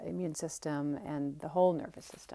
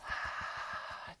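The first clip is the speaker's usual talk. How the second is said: long audible breath out through the mouth; slow yoga breath out, all air and no voice; exhale through mouth. Shown as a demonstration. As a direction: let out a long, airy demonstration exhale. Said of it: "exhale through the mouth".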